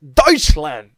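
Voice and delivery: "Deutschland" repeated loud, as /tɔɪtʃlænd/; repeating loudly. Shown as a demonstration. The vlogger loudly leading viewers through "Deutschland". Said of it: "say with me".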